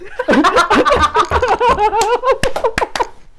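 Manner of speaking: high, loud burst of laughter. Real, not acted. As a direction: let out a big squealing laugh. Big laugh at the story.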